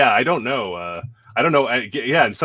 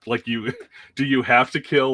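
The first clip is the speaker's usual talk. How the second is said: usual speaking voice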